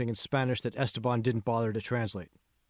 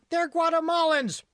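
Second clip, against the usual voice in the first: much higher, louder indignant shout; 5 dB louder than usual. A narrator's reading. Teenage girl correcting the supervisor, indignant shout. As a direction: shout back to correct him. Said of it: "shouted Christine".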